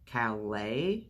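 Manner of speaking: Calais said the French way, ka-LAY; questioning ka-LAY with a rise. A narrator's reading. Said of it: "But she said Callis".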